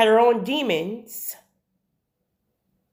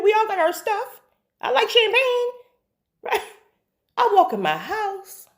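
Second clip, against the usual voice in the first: much higher, chuckling reassurance; laughing aside; 9 semitones higher than usual. Real, not acted. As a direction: laugh it off as no judgement, pitched high. Laughing aside about judging.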